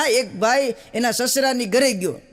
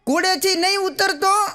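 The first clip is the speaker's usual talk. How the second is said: yelling at the screen; 7 semitones higher than usual. Retold or imitated. Husband yelling at DVD of his wedding, mock shouting. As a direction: shout at the movie hero, pleading. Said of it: "જોર જોરથી રાડો નાખે".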